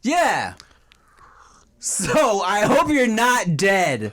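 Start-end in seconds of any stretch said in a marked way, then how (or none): 1.8-4.1 mock sweet. Mock takeback, drawn out.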